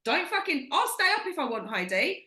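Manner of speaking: mock indignant snap. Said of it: "But I was like".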